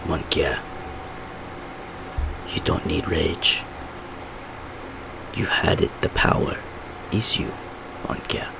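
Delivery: hushed flashback voice; Frenchie. Acted. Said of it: "he's like".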